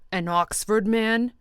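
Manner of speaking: loud, high disbelieving echo; incredulous. A narrator's reading. Tom to Jordan, loud incredulous echo. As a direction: throw the words back in disbelief. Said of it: "He was incredulous".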